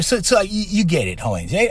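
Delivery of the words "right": normal talking voice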